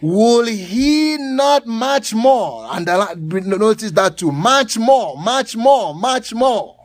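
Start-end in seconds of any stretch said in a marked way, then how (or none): 0.0-2.7 drawn-out, weighty phrase; slow emphasis. Acted. Preacher stressing God's care, slow emphatic reading. 4.3-6.8 repeated, louder and higher, each one punched; building emphasis. Acted. Preacher repeating a phrase to drive it home, emphatic.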